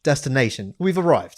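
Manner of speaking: a touch of posh British pronunciation; lightly put-on British accent. Shown as a demonstration. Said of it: "half of having a British accent is just saying slightly bigger words".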